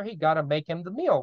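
ordinary delivery

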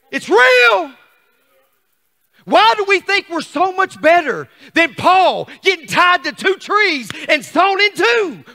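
shouted, high-pitched challenge to the congregation; full-force preaching. Real, not acted. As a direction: cry it out from the pulpit.

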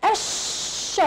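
الش whispered, lam dropped, shin held; voiceless held الش; doubled shin demo. Shown as a demonstration. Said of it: "لفظناها مرتين".

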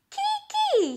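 very high mouse squeak. Acted. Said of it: "he said".

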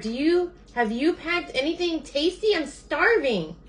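high, pleading bird voice; starving Bird. A narrator's reading. Bird begs Sheep for food, high starving plea. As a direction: beg in a high voice, stretching the last words.

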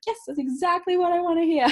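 high, delighted exclamation; excited reaction. Acted. Host reacting to a client's testimonial, excited and high. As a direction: pitch it way up, thrilled to hear it.

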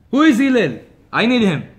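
loud demanding mock yell; rude shout. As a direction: call out impatiently for the rabbi. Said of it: "he screams in his window".

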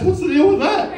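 high, loud Seinfeld-style whine; mock Seinfeld rant about bikes. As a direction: do the Seinfeld complaint, laughing. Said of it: "Maybe I'm talking like Seinfeld".

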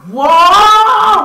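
high, loud whoa; surprised whoa. Real, not acted. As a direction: gasp it out loud. Surprise at on-fire character.

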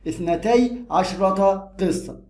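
very slow, spell out اثنتي عشرة قصة; deliberate dictation pace; slow model phrase. Shown as a demonstration. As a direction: dictate so every ending is clear. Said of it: "teacher models the full phrase".

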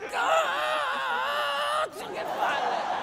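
put-on high, cracking kid's tantrum yell; tantrum shout. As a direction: explode like a spoiled child. Kid's tantrum at being denied.

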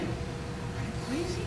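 breathless whisper of disbelief; incredulous Saul. Retold or imitated. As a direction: hiss it in alarm. Saul talks David out of it.

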